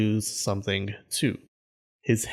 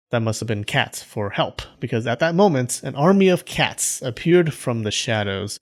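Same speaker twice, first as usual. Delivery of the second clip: ordinary delivery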